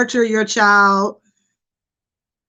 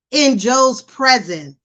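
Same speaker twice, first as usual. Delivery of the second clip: usual speaking voice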